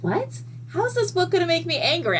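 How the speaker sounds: high-pitched disbelieving question; high, rushed mock disbelief; incredulous. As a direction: ask it high and fast, baffled. Disbelief at friend's warning, retold.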